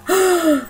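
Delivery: sharp, high startled gasp; hammed-up fright gasp; mock gasp. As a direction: gasp as if they just appeared. Heroine sees ghost kids appear.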